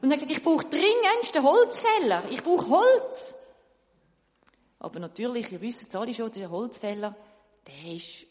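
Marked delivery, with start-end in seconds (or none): 0.4-3.3 loud, high, urgent cry for wood; desperate shout. Acted. Smith shouting that he needs the woodcutter.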